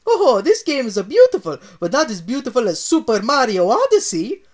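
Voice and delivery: high, loud Italian plumber admiring; Mario praises Zelda, high Italian voice. Acted. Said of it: "Mario said".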